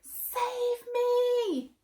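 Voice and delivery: high, loud begging voice; high loud pleading. Acted. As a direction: beg for help. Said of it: "begged Melon".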